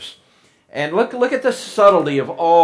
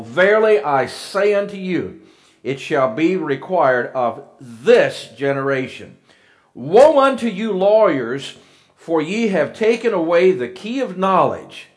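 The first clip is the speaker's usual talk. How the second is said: loud, raised scripture proclamation; preaching at full force; 3 semitones higher, 5 dB louder than usual. Acted. Preacher reading Jesus' woes to the congregation, loud and raised. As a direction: declaim it like a fiery preacher.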